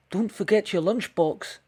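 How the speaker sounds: a hint of a caring bear; lightly voiced bear. The bear calls after Red.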